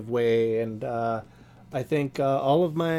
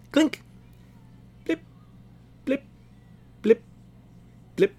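mouthed sound effects; 11 semitones higher than usual. Acted. Mouth sound effects for blood drops.

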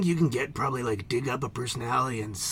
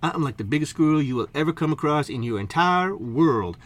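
ordinary talk

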